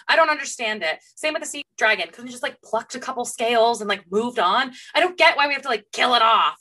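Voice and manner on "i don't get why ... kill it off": ordinary delivery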